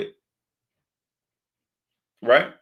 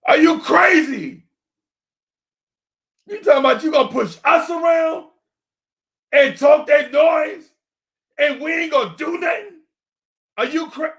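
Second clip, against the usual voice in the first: much higher, heated yell, dragged out at times; real yelling; 9 semitones higher than usual. Real, not acted. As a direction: shout it down the mic at the player. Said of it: "I'm yelling at a microphone right now".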